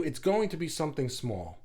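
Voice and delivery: ordinary talk, nothing marked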